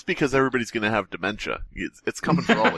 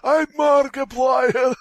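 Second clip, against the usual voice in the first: mock old shout; 11 semitones higher, much slower than usual. Friend claiming to be Markiplier, shouting high. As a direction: ham up a proud old YouTuber.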